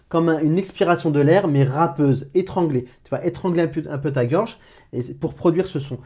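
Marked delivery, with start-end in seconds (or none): none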